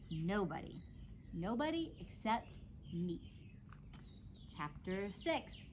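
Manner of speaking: ordinary delivery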